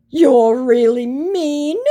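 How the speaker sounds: very high, loud, drawn-out mock hurt; exaggerated wounded whine, held long. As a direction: mimic him acting wounded. Mimics his hurt sad-face message.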